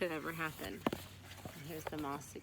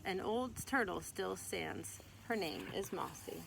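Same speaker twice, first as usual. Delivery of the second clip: gentle, lively read-aloud voice; storybook reading; 5 semitones higher, 4 dB louder than usual. Acted. Mother reading a picture book to her child, gentle storytelling.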